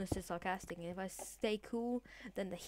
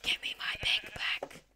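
slow, intense stage whisper; forceful whisper; slower than usual. Pretend. Player demanding a pick back, slow whisper. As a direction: drag out the demand without voice.

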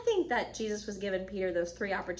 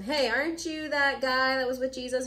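accusing question; 4 semitones higher, 4 dB louder than usual. Acted. Bystanders accuse Peter.

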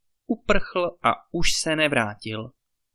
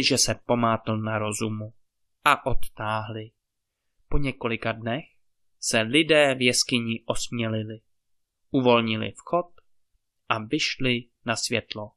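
plain reading voice

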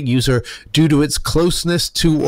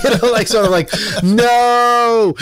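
laughing; 10 semitones higher, 3 dB louder, slower than usual. Real, not acted. Laughing at creepy teacher idea.

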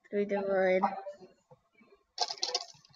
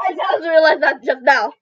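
ordinary delivery

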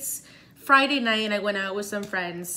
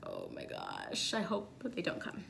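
hushed dread; 14 dB quieter than usual. Retold or imitated. Hoping the firemen won't come, she murmurs in dread. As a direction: barely breathe it, dreading.

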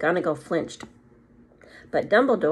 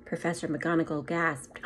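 plain reading voice

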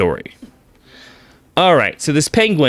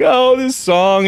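tearful whine; 11 semitones higher, slower than usual. Retold or imitated. Crying penguin voiced.

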